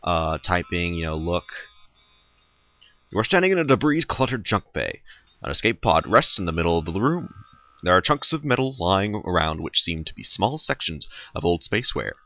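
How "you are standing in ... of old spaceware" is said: game text read aloud; story narration. Acted. Reads room description aloud.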